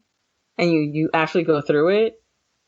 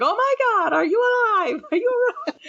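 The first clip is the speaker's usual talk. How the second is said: high, hammed-up alarm with a laugh; mock-frantic, much higher, laughing at the end; mock panic. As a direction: ham up the frantic friend checking in. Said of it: "I was like".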